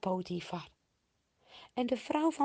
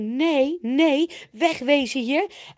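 raised, louder acted refusal; urgent refusal; 6 semitones higher, 9 dB louder than usual. A narrator's reading. Jozef thinks he must flee the wife, urgent refusal. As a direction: blurt it out, eager to get away.

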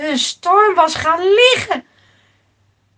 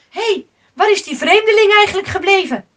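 quick, raised and higher voice; sudden calling out; 6 semitones higher, 6 dB louder, faster than usual. Acted. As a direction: call out a hurried question. Sailor suddenly asks in the storm. (gehaast roepen)

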